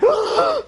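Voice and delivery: high-pitched mock gasp; girlish gasp. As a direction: gasp like a startled girl, hammed up. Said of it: "he's like a girl".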